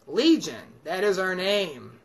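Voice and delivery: ordinary talk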